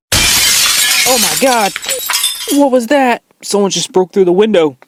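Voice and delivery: high panicked startled cries; frightened. Acted. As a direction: gasp out in shock at the crash. Skit: someone breaks the window.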